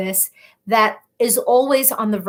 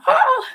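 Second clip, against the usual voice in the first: delighted gasp; 3 dB louder than usual. Real, not acted. Reacting to chat comment.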